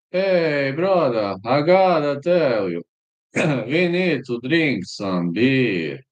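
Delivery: put-on playful high Norwegian accent; slow, chummy Norwegian accent. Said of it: "Norwegian accent to me".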